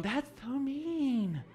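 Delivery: high, very slow, drawn-out prissy pity; high sing-song tut-tut, dragged out slow; mock caring folks. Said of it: "caring folks are going".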